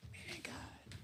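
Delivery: retold weary whisper to God; hushed dismay. Believer in a hard moment with God, retold in a dismayed whisper. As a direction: let it drop to a faint, voiceless murmur.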